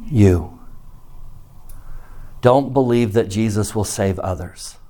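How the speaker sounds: ordinary talk, nothing marked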